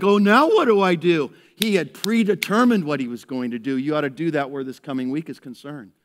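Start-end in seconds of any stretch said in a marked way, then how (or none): 0.0-1.3 flustered question. Retold or imitated. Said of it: "It wasn't like".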